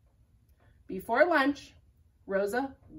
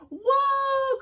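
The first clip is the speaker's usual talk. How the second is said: higher, louder squeaky whoa; germ cry; 7 dB louder than usual. Acted. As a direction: cry out as it swirls away. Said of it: "cried the germ".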